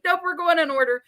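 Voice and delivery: high, firm mock refusal; playing her stubborn old self, up high. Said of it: "I sounded horrible".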